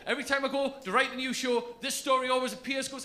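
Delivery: ordinary delivery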